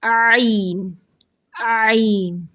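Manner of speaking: عین said from the throat; ain demo. Shown as a demonstration. Teacher introducing the new letter. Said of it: "اپنا next letter دیکھتے ہیں".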